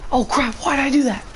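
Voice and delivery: high, fast panicked yelp. Real, not acted. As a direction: blurt it out in alarm. Panicking after mistake in game.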